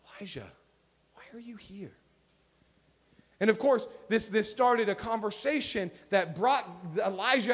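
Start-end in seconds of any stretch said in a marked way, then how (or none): 0.1-2.0 very soft, hushed question; whispered. Acted. God asking Elijah again in the cave, whispered. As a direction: breathe it out barely audibly, pausing after the name. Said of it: "what God said when he whispered".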